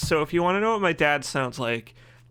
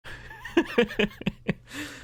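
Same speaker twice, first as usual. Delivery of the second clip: high-pitched burst of laughter; laughing. Real, not acted. Streamer cracking up at saying tide backwards, a high laugh. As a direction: let a squeal of a laugh slip out.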